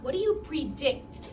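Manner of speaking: ordinary talk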